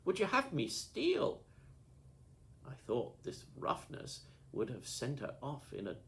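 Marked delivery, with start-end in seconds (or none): 0.0-1.5 high, loud, put-on outrage; indignant, hammed-up challenge; mock fury. A narrator's reading. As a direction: snap it back as a show of anger. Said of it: "with the same appearance of fury".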